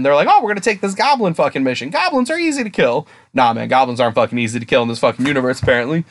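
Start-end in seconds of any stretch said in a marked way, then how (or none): 0.3-3.1 higher-pitched cocky rookie chatter; mock the overconfident newbies in a high voice; naive confidence. Retelling the rookie party taking a goblin mission, naively confident.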